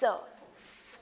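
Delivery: whispered, drawn-out hiss स like a snake. Shown as a demonstration. Teacher demonstrating the s sound to children. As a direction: let the स hiss out softly. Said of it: "आपके lips स".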